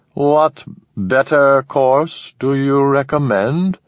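slow, high alien monotone; cold measured alien. Acted. Alien Manek speaks.

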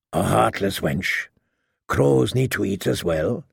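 low, understated taunt; sardonic prisoner. A narrator's reading. Chained prisoner mocking his captor's scruples, low and dry. Prisoner needles the woman knight.

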